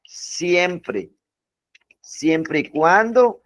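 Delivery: "siempre y cuando" drawn out slowly; slow emphasis. Shown as a demonstration. Teacher warning students, slow and insistent. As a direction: lean on the condition, unhurried.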